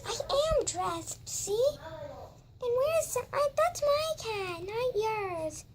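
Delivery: put-on high, slow childish doll voice; little kid doll voice.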